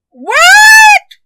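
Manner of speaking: very high, loud shriek of surprise; granny's startled high yelp; shocked cry. A narrator's reading. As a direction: shriek it in utter disbelief. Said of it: "cried Granny".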